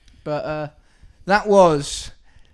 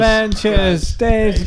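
loud jingle shout; 5 semitones higher, 8 dB louder, much slower than usual. Acted.

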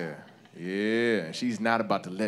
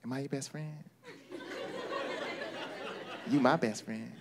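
fiancée's soft plea; 5 semitones higher, 10 dB quieter than usual. Acted. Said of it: "Like, she's like, uh".

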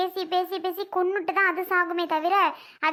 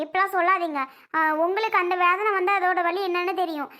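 normal talking voice

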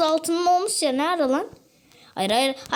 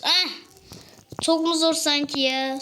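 ordinary delivery

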